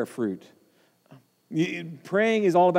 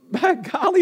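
higher, chuckling through it; laughing exclamation; 10 semitones higher than usual. Real, not acted. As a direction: laugh at the joke you just made.

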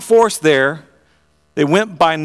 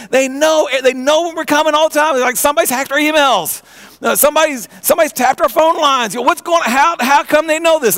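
enraged king; 8 semitones higher than usual. Acted. Enraged king voiced in story.

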